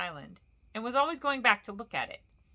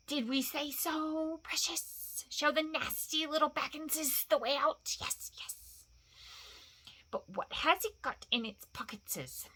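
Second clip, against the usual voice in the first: slow, higher creature voice, hissed; Gollum, wheedling and sly; 6 semitones higher, slower than usual. Acted. Narrator voices Gollum, riddle game aftermath.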